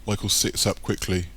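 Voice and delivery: plain reading voice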